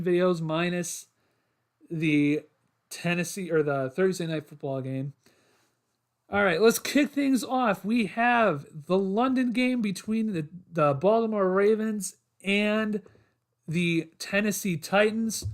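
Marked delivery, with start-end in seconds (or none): 6.3-15.4 high, slow, showy show-host kickoff; announcer voice. Acted. Kicks off predictions like a host.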